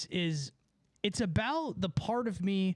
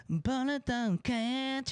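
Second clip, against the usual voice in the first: higher, slow, stretched-out performer voice; put-on studio voice; 5 semitones higher, slower than usual. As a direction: drawl it out high as if recording in the booth. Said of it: "I'm going like".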